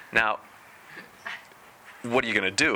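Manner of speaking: ordinary talk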